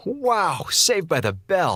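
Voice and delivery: usual speaking voice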